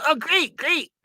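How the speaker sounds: high mock sarcastic oh great; mock high Ralph. Friend caught owing, mock high sarcastic reply.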